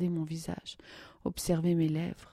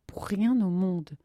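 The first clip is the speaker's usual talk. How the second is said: louder, higher, still shaken; terrified; 4 semitones higher, 7 dB louder than usual. Acted. Nadia, shaken after the sled ride, frightened. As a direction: blurt it out in fright. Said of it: "avec de grands yeux pleins de terreur".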